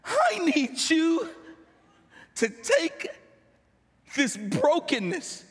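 pleading prayer that swells toward a cry; anguished prayer. Retold or imitated. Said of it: "I just cried out to God".

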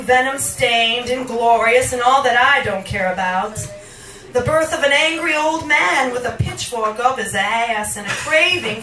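emphatic, declamatory delivery; forceful poetry reading. Acted. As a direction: declaim it in long rolling phrases. Reads a poem aloud.

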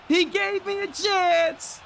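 stretched-out high disbelieving yells; excited shouting. Real, not acted. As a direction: yell it slowly in pure hype. Still hyped over the chance.

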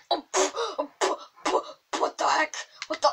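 loud, high spluttering outburst; panicked puffs and yelps; startled. Real, not acted. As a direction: splutter as if suddenly attacked. Suddenly attacked in game.

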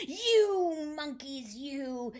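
angry shout. A narrator's reading. Peddler stamping both feet at monkeys, slow angry shout. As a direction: drag out the first word, high and cross. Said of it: "stamped both his feet and shouted".

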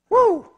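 high loud celebratory whoop; a preacher's excited whoop; joyful woo. Real, not acted. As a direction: whoop it out with joy. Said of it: "celebrating shout".

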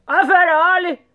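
high, loud tyrant's yell; shouted threat. A narrator's reading. Sultan threatening to behead the rat, shouting. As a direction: yell a warning at the underling. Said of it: "råbte sultanen".

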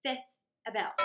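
ordinary delivery, nothing marked

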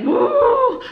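high, drawn-out "woo"; exclaimed woo. Acted. Mom to her viewers about hard times, exclaimed woo. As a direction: let out a high-pitched whoop. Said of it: "sometimes I'm like".

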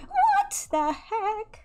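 very high, drawn-out disbelief; startled squeal. Real, not acted. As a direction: squeal it slowly in shock. Startled in the game.

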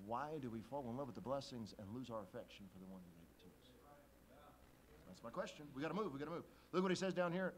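ordinary delivery

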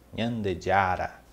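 "Nyandejara" said slowly and clearly; slow Guarani word. Shown as a demonstration. Teacher repeats Nyandejara slowly for notes. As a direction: spell out "Nyandejara" at a slow pace. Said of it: "Marquem aí".

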